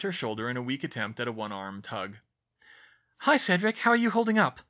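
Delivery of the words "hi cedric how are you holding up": put-on nervous, high girl's voice. A narrator's reading. Hermione nervously greets injured Cedric at his bedside. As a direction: ask it haltingly, unsure of yourself. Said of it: "Hermione said nervously".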